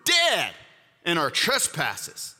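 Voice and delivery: steady, measured scripture reading; reading scripture to the congregation. Acted. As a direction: read the verses with reverent weight. Reads scripture passage aloud.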